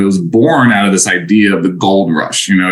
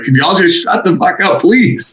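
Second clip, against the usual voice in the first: retold plea in a raised pitch; pitched-up, fed-up pleading; exasperated plea; 9 semitones higher than usual. Acts out exasperated plea for quiet.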